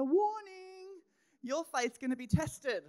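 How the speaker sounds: high, loud alarm-like call; Jesus warning Peter, hammed up as an alarm; mock warning announcement.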